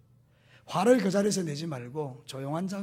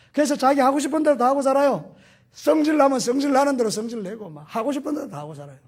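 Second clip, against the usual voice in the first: higher and louder, hammering 대로; loud preaching rebuke; 7 semitones higher, 7 dB louder than usual. Real, not acted. Pastor scolds believers who live as they please, loud rebuke. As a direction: preach it at full force.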